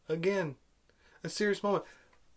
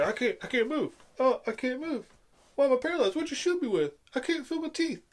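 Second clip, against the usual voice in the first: high-pitched whiny mockery of a stunned man; mock a helpless victim in a squeaky high voice; hammed-up high panic; mock panicked Finn; 7 semitones higher than usual. Mocking Finn's panicked lines in film.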